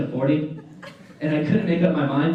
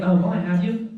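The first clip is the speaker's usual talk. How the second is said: ordinary talk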